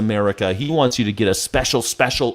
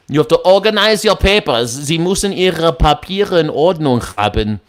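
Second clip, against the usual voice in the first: put-on higher, stern German accent; German officer accent; 3 semitones higher than usual. Acting a German official again.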